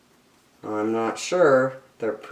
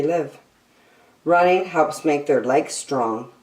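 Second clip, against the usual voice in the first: bright, lilting picture-book read-aloud; reading to children; 9 semitones higher, 5 dB louder than usual. Acted. Reading an animal picture book to young children, bright and lilting. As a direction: read it like a bedtime nature book for kids.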